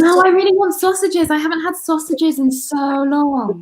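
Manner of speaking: high eager plea; pleading excitedly for sausages. Real, not acted.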